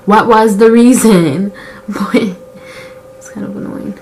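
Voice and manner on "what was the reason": loud, drawn-out mock demand; exasperated demand. Asking herself why she wants to cry, exasperated. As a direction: demand it loudly, stretching it out.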